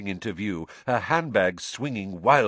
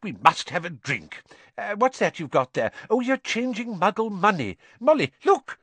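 growing excitement; 11 semitones higher than usual. A narrator's reading. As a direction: get more thrilled with every line. Mr Weasley thrilled by Muggle money.

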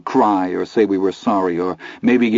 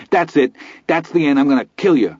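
enraged; 6 semitones higher than usual. Acted. Teddy snapping in rage.

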